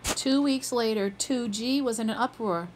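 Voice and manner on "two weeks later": usual speaking voice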